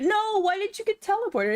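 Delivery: high, disbelieving question; surprised. Real, not acted. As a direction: sound baffled and let down. Surprised raid glitch.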